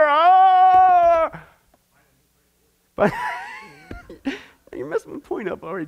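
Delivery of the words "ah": loud high jolt awake; startled yelp. Retold or imitated. Mock startled wake-up.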